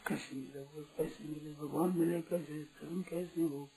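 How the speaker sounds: very soft, voice dropped right down; longing seeker's plea. Acted. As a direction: ask it softly, like a seeker yearning for God.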